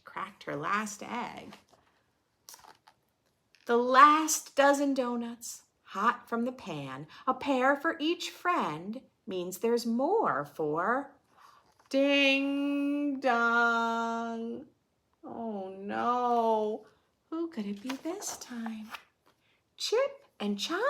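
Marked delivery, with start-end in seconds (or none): 3.7-11.1 put-on lively read-aloud for kids; children's story narration; storybook reading. A narrator's reading. Reading picture book aloud. 11.9-14.6 ding dong like a doorbell; playful doorbell sound; doorbell chime. A narrator's reading. Doorbell rings in story. 15.2-20.8 put-on lively read-aloud for kids; children's story narration; storybook reading. A narrator's reading. Reading picture book aloud.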